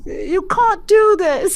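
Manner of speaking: a dramatic British schoolboy line; Harry Potter accent. Retold or imitated. Teacher imitating her student's accent game: slow Harry Potter British English. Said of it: "the Harry Potter accent".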